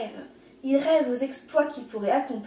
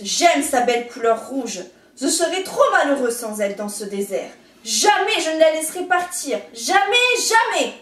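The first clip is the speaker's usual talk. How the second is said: loud grumpy refusal; stubborn angry cactus; 3 semitones higher, 7 dB louder than usual. A narrator's reading. Cactus keeping the feather, loud stubborn. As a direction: hammer out the refusal.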